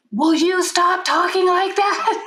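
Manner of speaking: high, slow mock teen complaint; a whiny kid mocked lightly; imitating her teenager. Mother mimics her teenage son's irritated complaint about her calm tone, high and slow. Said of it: "my thirteen-year-old".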